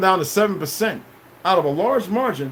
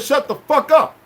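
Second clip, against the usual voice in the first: higher, curt shut-down; blunt rude command; 8 semitones higher, slower than usual. Retold or imitated. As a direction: snap it at them like an order. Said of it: "you didn't like the way I said it".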